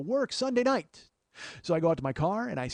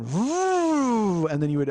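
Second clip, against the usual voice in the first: engine revving; 6 semitones higher, 5 dB louder, much slower than usual. Retold or imitated. Imitating car revving.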